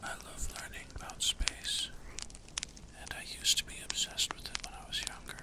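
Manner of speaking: ASMR whisper. Acted. ASMR streamer to viewers about space, whispering. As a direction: whisper gently to soothe the listener.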